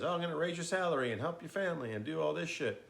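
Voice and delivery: playful impression of Scrooge bursting in; jolly Scrooge. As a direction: ham up Scrooge's generous announcement. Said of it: "Scrooge shows up at the house and says".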